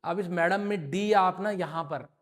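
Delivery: normal talking voice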